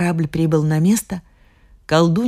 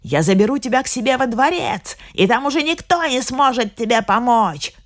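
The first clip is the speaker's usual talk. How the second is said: higher mock witch's yell; witch shouting; 6 semitones higher than usual. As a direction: threaten her with a hammed-up cry. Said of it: "кричала она". (ведьма кричит, выше обычного)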